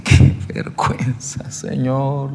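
tearful lament, voice breaking into air; retold weeping prayer. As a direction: re-enact crying out to God in shame. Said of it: "Y yo lloraba y le decía".

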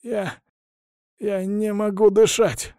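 halting, slow, high-pitched choked words; strangled rasp. A narrator's reading. Poisoned hostage unable to breathe, rasping. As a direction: choke it out as if airless. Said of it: "сдавленно прохрипел он".

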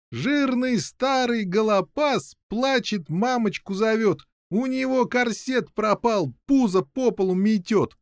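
high, sing-song mockery; teasing rhyme. A narrator's reading. Prisoners mocking the giant together, high teasing chant. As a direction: chant it slowly to taunt him.

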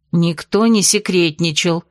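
usual speaking voice

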